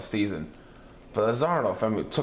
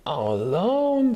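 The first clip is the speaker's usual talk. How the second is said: high, slow, louder sorrowful voice; sad little girl; 10 semitones higher, 6 dB louder, slower than usual. A narrator's reading. Velma missing Bob at night, slow and sad. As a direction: stretch it out sadly. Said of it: "said Velma".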